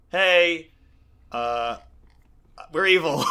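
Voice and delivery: hammed-up awkward reveal with pauses; mock awkward confession. Jokey voicing of revealed villains.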